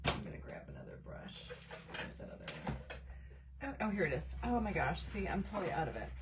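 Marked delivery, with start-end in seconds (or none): 0.1-2.8 hushed, voiceless aside to yourself; muttering to self. Real, not acted. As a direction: say it under your breath while searching. Talks to herself hunting a brush.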